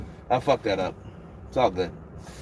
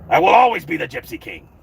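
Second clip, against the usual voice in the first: Fury impression; 5 semitones higher, 5 dB louder, faster than usual. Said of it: "I can see Fury".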